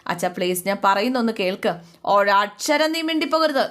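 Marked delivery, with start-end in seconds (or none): 2.0-3.6 angry father. Retold or imitated. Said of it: "അച്ഛൻ അങ്ങോട്ട് ശരിക്കും ദേഷ്യപ്പെടുക".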